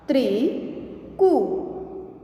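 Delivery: slow, each sound drawn out, rising at the end; slow drawn-out reading. Shown as a demonstration. Teacher slowly sounding out a word for students, drawn out. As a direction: stretch the word slowly for the class. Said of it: "आगे देखें".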